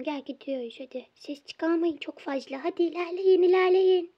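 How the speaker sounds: ordinary talk, nothing marked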